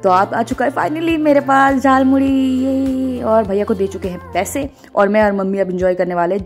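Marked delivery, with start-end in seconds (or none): 0.0-3.2 higher, drawn-out happy cheer; gleeful announcement. Real, not acted. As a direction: stretch it out into a delighted 'yay'. Food she waited for finally arrives.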